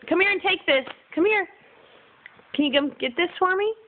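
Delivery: high, sing-song coaxing; coaxing mom voice. Real, not acted. Coaxing toddler to come.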